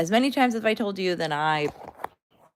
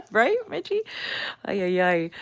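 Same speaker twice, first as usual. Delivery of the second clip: through laughter; 3 semitones higher than usual. Real, not acted. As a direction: squeak it out while still laughing. Still laughing at viewer's comment.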